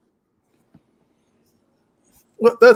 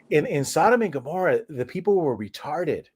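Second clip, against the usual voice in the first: ordinary delivery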